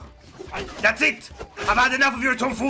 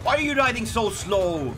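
ordinary delivery

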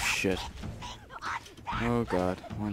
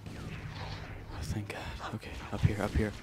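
whispering; 13 dB quieter, slower than usual. Real, not acted. Hiding from enemies, relieved.

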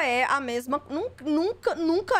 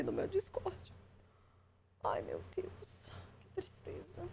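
sudden hushed whisper; 5 semitones higher, 16 dB quieter, slower than usual. Real, not acted. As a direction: drop to a breathless whisper, as if he might hear. Streamer whispers about Discord visitor.

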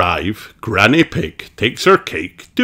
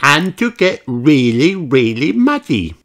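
higher, giggling as she says it; giggly; 7 semitones higher, 3 dB louder than usual. Acted. Peppa explaining the walk, giggling with delight. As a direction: laugh through the muddy bit. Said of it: "explains Peppa".

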